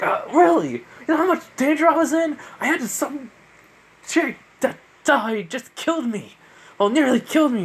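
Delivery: high, loud put-on girl's voice, indignant; high, huffy girl telling off a latecomer; scolding girl. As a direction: squeak it out like an annoyed teen girl. Lubak scolds the late Izuku.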